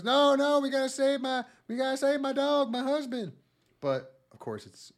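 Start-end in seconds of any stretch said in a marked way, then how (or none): 0.0-3.3 high, frantic pleading voice; frantic hunter. Retold or imitated. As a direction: shout high in panic to save him. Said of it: "the hunter at a certain point goes".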